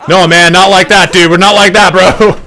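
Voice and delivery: high, loud, hurried backpedalling; mock-alarmed pleading; retold panicked protest.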